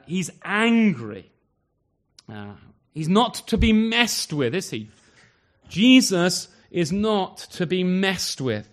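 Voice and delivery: preacher thundering that Jesus gets angry; preaching at full force. Acted. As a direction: hammer it home to the congregation.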